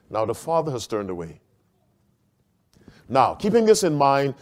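ordinary talk, nothing marked

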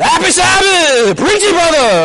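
hammed-up fervent churchgoer; exuberant congregation response. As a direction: holler it back to the preacher.

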